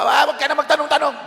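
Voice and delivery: high, rushed parental brush-off; dismissive parent. Acted. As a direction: snap back like a parent shutting down questions. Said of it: "minsan yung mga magulang".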